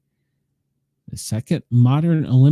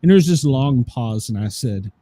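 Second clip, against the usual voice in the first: normal talking voice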